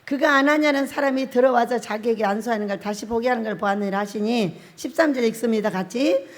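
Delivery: ordinary talk, nothing marked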